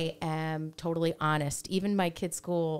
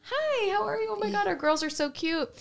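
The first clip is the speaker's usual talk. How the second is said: high, gushing mock-friendly greeting; high gushing hello to another mom at pickup. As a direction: overdo the sweetness, pitched way up. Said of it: "I'm like".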